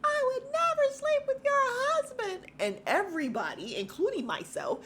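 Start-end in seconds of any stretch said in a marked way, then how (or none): 0.0-2.5 shrieky, very high imitated yell; Jacqueline. Jacqueline denying it to Mia, shrieky. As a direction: shriek it in over-the-top protest. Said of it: "And then she says".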